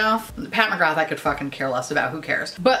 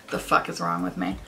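ordinary delivery

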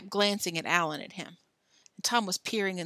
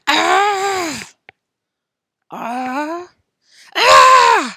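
loud high groans; sick groaning; 9 semitones higher, 16 dB louder, much slower than usual. A narrator's reading. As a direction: moan like feeling sick.

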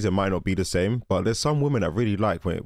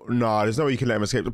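usual speaking voice

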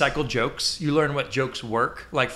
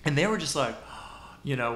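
usual speaking voice

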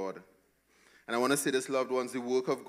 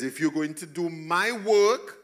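higher, loud commanding divine voice; voicing God; 8 semitones higher, 8 dB louder than usual. Pastor voicing God's promise of strength, loud and firm. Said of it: "God says".

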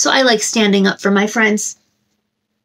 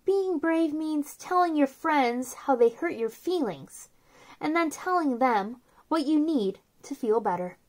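soft, gentle read-aloud of a picture book; storybook reading; 3 semitones higher, 10 dB quieter than usual. Acted. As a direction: read it softly to little kids, like a bedtime book.

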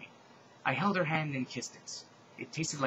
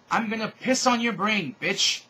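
put-on high, loud German-accented yell; German accent scream; 8 semitones higher, 8 dB louder, slower than usual. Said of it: "only in a German accent".

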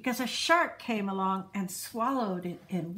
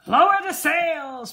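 loud, higher storm order; shouted; 6 semitones higher, 9 dB louder than usual. A narrator's reading. Braidbeard ordering the crew in the storm, loud shout. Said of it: "Braidbeard shouted".

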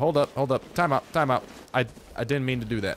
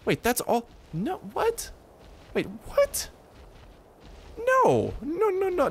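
high-pitched disbelieving no; shocked disbelief; slower than usual. Real, not acted. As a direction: squeal in disbelief. Finds only a death cache.

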